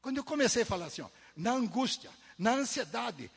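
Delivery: loud, rising preacher's call; fervent preaching. Acted. Preacher listing troubles to the congregation, fervent and loud. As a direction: proclaim it to the whole church.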